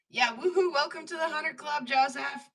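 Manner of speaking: loud, high celebratory welcome; excited cheer. Real, not acted. Lapidary streamer cheering new club members, loud and high.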